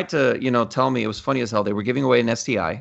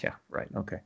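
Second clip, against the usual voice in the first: sarcastic whisper; 14 dB quieter than usual. Retold or imitated. Scoffing at fake prize offer.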